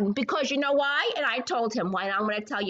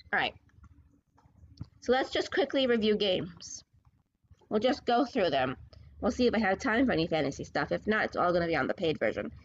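ordinary talk

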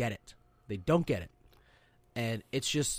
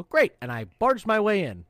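high, loud burst; brash mock triumph; 6 semitones higher, 6 dB louder than usual. Sailor barging into the admin office, brash and loud. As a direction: announce it like someone barging straight in.

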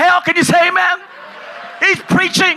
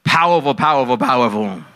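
mock worker answer; 14 semitones lower than usual. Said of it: "And they always go".

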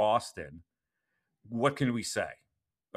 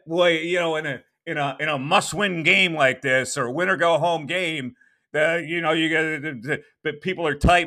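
imitating J J; 7 semitones higher, 7 dB louder than usual. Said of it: "He said".

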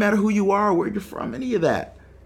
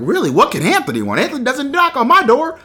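retold disbelief, climbing high by the end; incredulous reaction; 10 semitones higher, 6 dB louder than usual. Puzzled by neighbor Anthony at the door, incredulous. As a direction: act out your baffled reaction.

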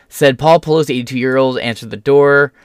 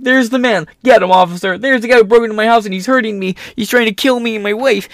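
mock frantic victim; 8 semitones higher than usual. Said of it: "would've ran out and been like".

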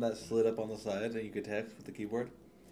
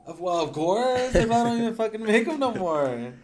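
louder, raised rant; 10 semitones higher, 12 dB louder than usual. Real, not acted. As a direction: rant about it loudly. Ranting about sold-out phones.

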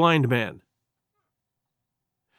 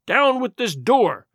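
slow, raised-pitch order; cried command; 5 semitones higher, slower than usual. A narrator's reading. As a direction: cry it out as a drawn-out command. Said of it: "he cried".

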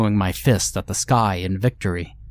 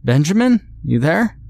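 high, loud desperate call; 8 semitones higher, 5 dB louder than usual. Acted. Father desperately calling for his son, loud high call. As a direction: shout out for him, hoping.

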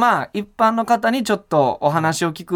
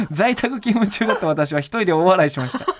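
ordinary talk, nothing marked

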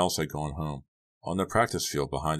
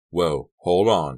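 loud, drawn-out call to stop; crying out; 8 dB louder, much slower than usual. A narrator's reading. Boy stopping a girl going alone, crying out. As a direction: cry out slowly and loudly. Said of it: "Pete cried".

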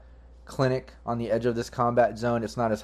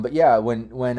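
ordinary talk

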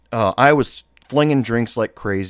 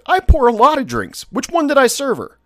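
much higher, mock-innocent defiance; uncooperative; 3 dB louder than usual. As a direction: play dumb and stonewall the inspector, pitched way up. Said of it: "I was being as uncooperative as I possibly could".

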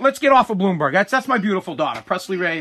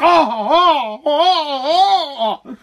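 higher, hammed-up gasps of horror; mock shock; 5 semitones higher, 3 dB louder, much slower than usual. Neighbors reacting to a fart, mock horror. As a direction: act scandalized over something trivial.

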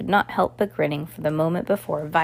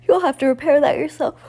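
calling out; 8 semitones higher, 6 dB louder than usual. A narrator's reading. Violet calling down ladder.

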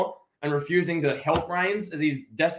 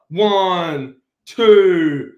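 mock referee count; 3 semitones higher, 9 dB louder, much slower than usual. Referee count, three-count in the ring.

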